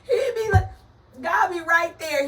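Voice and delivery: high, loud words through laughter; helpless real laughter, very high-pitched; laughing hard. Real, not acted. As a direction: squeal it out while cracking up. Cracking up at her own story.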